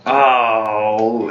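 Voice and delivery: ordinary talk, nothing marked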